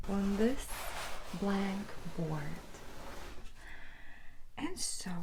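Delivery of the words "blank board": ordinary delivery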